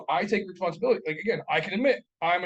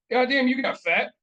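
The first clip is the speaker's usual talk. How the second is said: loud, higher, slow blunt remark; retold uncle's jab; 7 semitones higher, 7 dB louder than usual. Uncle greeting him at the family party, loud slow mock jab. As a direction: mimic the uncle blurting it out loud. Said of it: "and he goes".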